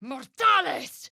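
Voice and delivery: slow, shouted spell word; shouted incantation. Acted. Caster shouting a Latin spell word at foes. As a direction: call the spell out with force.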